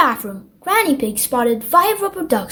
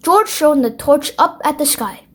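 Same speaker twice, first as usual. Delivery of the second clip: ordinary delivery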